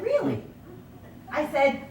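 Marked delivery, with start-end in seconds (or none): 0.0-0.4 surprised. Retold or imitated.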